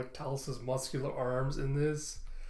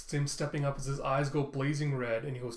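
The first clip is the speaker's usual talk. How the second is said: ordinary delivery, nothing marked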